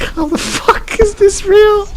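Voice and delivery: high, slow, laughing through it; laughing disbelief. Retold or imitated. Retelling own reaction to a friend, slow laughing disbelief. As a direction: squeeze it out between laughs.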